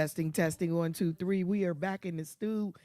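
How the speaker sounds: booming mic check; loud announcing. Real, not acted. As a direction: boom it out like a host. Loud mic check, show opener.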